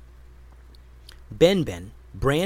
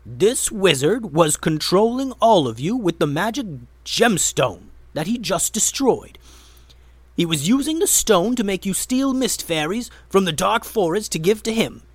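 urgent explanation; 6 semitones higher, 5 dB louder than usual. A narrator's reading. Gray exposes the wizard.

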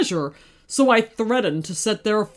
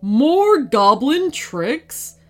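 loud flaring dragon anger; angry dragon; 3 semitones higher, 5 dB louder, much slower than usual. Acted. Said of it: "flared the dragon angrily".

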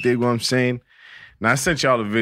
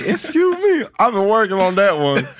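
high, loud hype voice; hype announcer; 10 semitones higher, 3 dB louder than usual. Acted. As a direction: shout it like an MC. Redoing his intro.

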